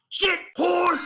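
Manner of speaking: sudden high-pitched yell; mimicked involuntary shriek; imitated outburst. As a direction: blurt it out in a high shout. Imitating friend's sudden Tourette outburst.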